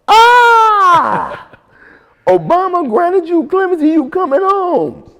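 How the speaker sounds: imitated high-pitched scream of joy; very loud, high celebratory squeal, acted out; excited scream. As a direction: shriek the big news like she did. Acting out her screamed good news.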